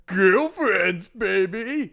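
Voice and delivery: loud, high celebratory shout; hyped cheer. Pretend. Player cheering the new chapter title, hyped shout. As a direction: whoop it out playfully.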